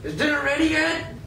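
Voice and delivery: high, demanding question; impatient master. Acted. Master demanding dinner from house slave.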